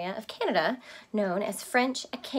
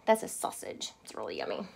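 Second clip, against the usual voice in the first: quiet aside; 13 semitones lower, 6 dB quieter, faster than usual. Real, not acted. Aside to children.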